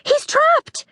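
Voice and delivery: very high alarmed cry; panicked girl. A narrator's reading. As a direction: shriek the danger. Girls panic.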